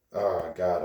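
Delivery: ordinary talk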